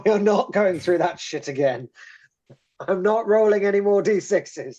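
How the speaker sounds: high, loud laughing protest. Real, not acted. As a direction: refuse loudly, half laughing. Player protests repeating bad rolls.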